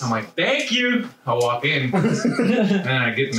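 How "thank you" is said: bright high sarcastic thank you; fake-polite thanks to the insult; mock-cheery thanks. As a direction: chirp it back, sweet as pie.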